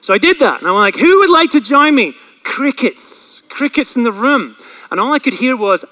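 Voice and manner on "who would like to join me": loud, high shouted invitation; big, high public holler; screaming out. Retold or imitated. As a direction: call it out to the whole room. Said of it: "I'll scream out to everybody".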